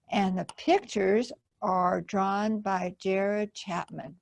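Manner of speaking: usual speaking voice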